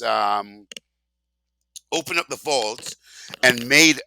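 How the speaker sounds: usual speaking voice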